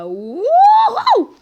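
high, loud cheer; excited whoop. Acted. Hero whoops as he launches into action. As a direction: whoop with joy as you launch.

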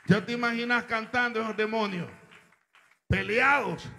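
preacher's scandalized cry; indignant outburst. Real, not acted. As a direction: shout the disbelief.

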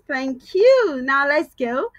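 usual speaking voice

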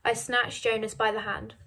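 ordinary delivery, nothing marked

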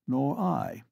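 plain reading voice

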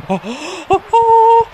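slow, stretched, rising high cry; ecstatic wail. Real, not acted. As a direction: let the celebration climb into a squeal. Celebration builds to a scream.

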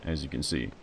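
usual speaking voice

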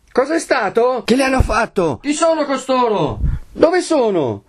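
high-pitched clamouring questions; shouted by a crowd. A narrator's reading. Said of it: "gli veniva gridato da cinquanta voci". (domande gridate dalla folla)

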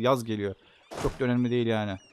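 usual speaking voice

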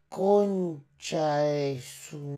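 lentísimo y bajito, sílabas alargadas; asombro en cámara lenta. Acted. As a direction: estira cada sílaba como en cámara lenta. (lisura susurrante, estirada al máximo)

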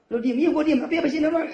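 hammed-up bickering wife; shrill nagging wife. Wife retorting to her husband, loud and high-pitched. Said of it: "wife mocking husband's nagging".

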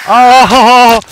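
loud high scream of shock; real jump-scare scream; startled scream. Real, not acted. Player hit by a jump scare, screaming.

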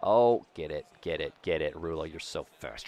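usual speaking voice

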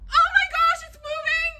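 very high, tearful cries of fright; high shrieks fading to whimpers; panicked squealing. Real, not acted. Spider is moving.

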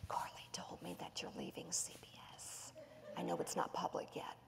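hushed, breathy whisper, leaning in; whispering teacher. Retold or imitated. Teacher leaning down to mother, confiding in a whisper. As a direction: whisper it like a secret. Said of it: "the teacher leans down and she goes".

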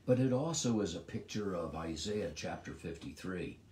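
normal talking voice

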